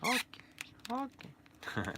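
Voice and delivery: very high squeaky menacing little man; a tiny creep luring you in, high-pitched; high squeaky voice of a sinister little doll; creepy toy voice. Acted. Toy voice answering.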